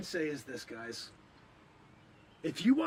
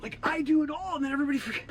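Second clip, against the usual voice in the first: high, incredulous complaint; indignant protest; 5 semitones higher than usual. Acted. Forgotten time traveler to his assistant, indignant. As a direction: protest with raised pitch, comically affronted.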